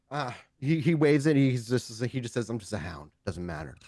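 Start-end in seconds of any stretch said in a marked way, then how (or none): 2.5-3.7 soft, low, self-effacing murmur; hushed, lowered voice of someone giving up; low and resigned. Acted. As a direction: brush it off quietly, dropping low. GM voices downcast captive dismissing himself.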